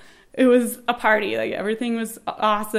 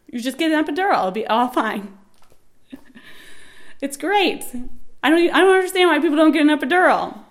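high, loud mock self-assurance; hammed-up, higher-voiced self-parody; breezy know-it-all; 7 semitones higher, 5 dB louder than usual. That girl who tells everyone, mock know-it-all. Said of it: "that girl that tells everyone".

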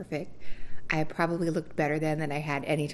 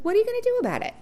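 very high, louder mocking taunt; mock the doctor's cheeky comeback; sassy retort; 8 dB louder, much faster than usual. The gynecologist taunting the patient, high and loud. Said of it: "And she was like".

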